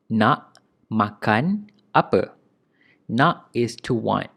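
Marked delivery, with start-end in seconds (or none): 0.1-2.4 slow model phrase. Shown as a demonstration. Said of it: "teacher repeats slowly".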